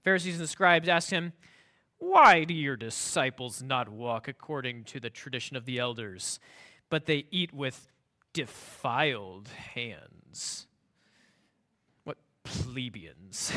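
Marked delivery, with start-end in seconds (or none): 2.0-10.7 slow, lower, haughty voice of a superior; condescending Pharisee. Retold or imitated. Reading the Pharisees' question in a snooty tone. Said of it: "can't you almost pick this up from them". 12.0-13.5 slow, low, haughty sneer; snooty sneer. Retold or imitated. Pharisees scoffing at the disciples, slow and snooty. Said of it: "they're talking down".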